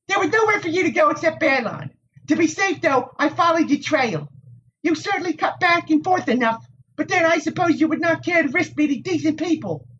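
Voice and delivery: put-on high, tart young woman's voice; prickly village Wisdom. A narrator's reading. Nynaeve to the Aes Sedai, tart and high. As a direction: needle him with a sharp tongue.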